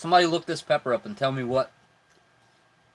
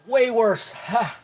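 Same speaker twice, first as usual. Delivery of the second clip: pained outburst; 5 semitones higher, slower than usual. Real, not acted. Reacting to burning hot pepper.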